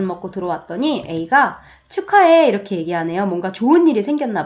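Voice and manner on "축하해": higher, louder happy congratulating; bright congratulations. Acted. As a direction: cheer them on. Friend congratulates. (신나게 축하하는 친구)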